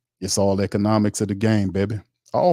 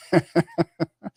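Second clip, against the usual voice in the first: sudden high burst of laughter; surprised laugh; 10 semitones higher than usual. Real, not acted. Streamer laughing at a viewer's joke, sudden high laugh. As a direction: laugh out, caught off guard. Caught off guard by chat joke.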